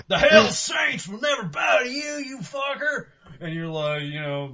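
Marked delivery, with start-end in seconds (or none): 0.1-3.1 high, loud defiant shout; hammed-up gang challenge; biker gang threat. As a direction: roar a gang war cry. Voicing rival biker gang member.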